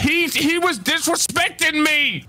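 high, whiny panicked pleading; frantic protest. Acted. Tommy running from the cops while pleading his case, high frantic whine. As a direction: yelp it out while bolting from the cuffs.

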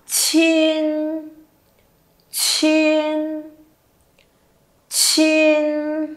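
airy burst opening each long "chin"; aspirated initial. Shown as a demonstration. Teacher carries on aspiration drill, "chin" with breathed onset. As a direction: puff air on "chin", then hold it level. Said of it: "Tiếp tục bật hơi nhá".

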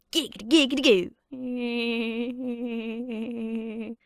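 breathy giggity with a drawn-out goo; Quagmire catchphrase. Retold or imitated. Sleazy neighbour's catchphrase, drawn-out leering giggity. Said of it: "some of these impressions".

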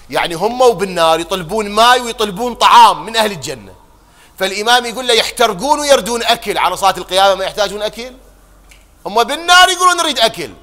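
loud, high impassioned sermon cry; fiery preaching. Acted. Preacher crying out to the mourners about hell, impassioned. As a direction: build the fire of the sermon.

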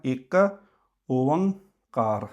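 ਇੱਕ then ਓਅੰਕਾਰ drawn out slowly; careful slow ਇੱਕ ਓਅੰਕਾਰ; slow model pronunciation. Shown as a demonstration. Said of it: "ਇਹਦੇ ਵਿੱਚ ਬਸ ਇਹੋ ਧਿਆਨ ਰੱਖਣਾ". (ਇੱਕ ਓਅੰਕਾਰ ਹੌਲੀ ਉਚਾਰਨ)

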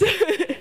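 brief, high-pitched chuckle; short chuckle. Real, not acted. Speaker joking with the audience as she starts, short chuckle. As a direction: let out a quick little laugh.

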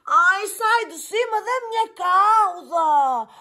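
high slow complaining cry; pained cry. A narrator's reading. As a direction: whimper about the tail, high. Lion's tail stepped on.

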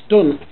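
"dunt" said with a short u, no o sound; Norfolk dunt. Shown as a demonstration. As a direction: say "dunt" the Norfolk way, rhyming with hunt. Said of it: "No, it's dunt, not don't".

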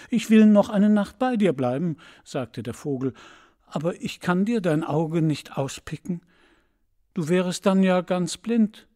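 the swallow protests, shocked; urgent refusal. Acted. Schwalbe weigert sich erschrocken. (höher und lauter flehend)

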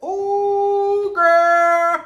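put-on very high, loud sung ooh girl; sassy ooh girl. Vlogger retelling the rude Panera girl, sassy sung ooh. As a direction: stretch it out with sass. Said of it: "And I was like".

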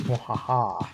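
evil mad scientist cackle; mad scientist laugh. Acted. Evil laugh while playing.

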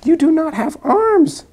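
high, loud elephant exclaiming; surprised exclaim. Acted. Elephant loudly telling the snake he has no arms.

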